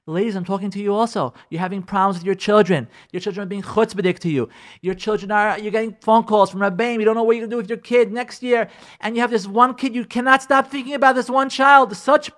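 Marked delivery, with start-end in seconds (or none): none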